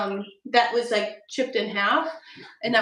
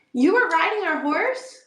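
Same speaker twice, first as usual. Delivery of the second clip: surprised gasp; 7 semitones higher, 5 dB louder than usual. Real, not acted. Mom hears child rode horse.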